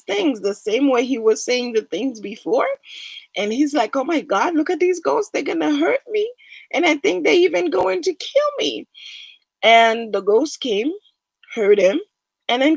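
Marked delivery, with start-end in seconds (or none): 4.0-8.9 high, rushed fearful pleading; scared of ghosts. Retold or imitated. Man sure the ghosts will kill him, high and afraid. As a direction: let the fear climb high as he names the ghosts.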